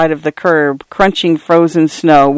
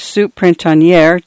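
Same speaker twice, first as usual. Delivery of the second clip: ordinary delivery, nothing marked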